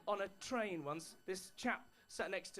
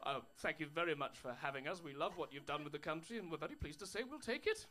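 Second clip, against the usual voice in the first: lower, mock-genteel colonial politeness; hammed-up courtesy while taking the country; overly polite Englishman; 6 semitones lower than usual. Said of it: "in a really extraordinarily polite way".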